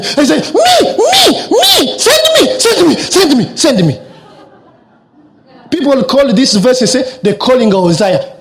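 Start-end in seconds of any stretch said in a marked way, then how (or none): 0.5-4.0 very high, excited pleading; like a kid begging to be picked; eager volunteer. Retold or imitated.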